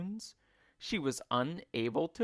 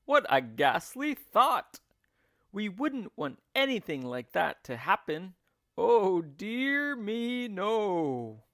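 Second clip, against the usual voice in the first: mock horror; 5 semitones higher, 4 dB louder than usual. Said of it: "exclaimed Mister Twit".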